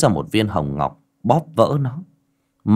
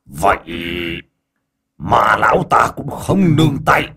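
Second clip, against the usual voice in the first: raised, forceful villain voice; menacing demon elder; 2 semitones higher, 3 dB louder than usual. Acted. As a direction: declare war as the demon elder. Demon elder threatens after laughing.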